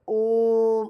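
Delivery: ओ held long and steady like a chanted Om; drawn-out Om. Shown as a demonstration. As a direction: stretch ओ out for three beats. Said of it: "ओम का उच्चारण करते हैं तो".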